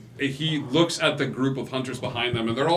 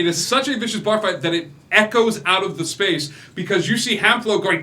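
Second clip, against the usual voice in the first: louder and higher, tumbling with hype; excited narration; 7 semitones higher, 6 dB louder than usual. A narrator's reading. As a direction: sell the chaos like a thrilled storyteller. GM hypes fight.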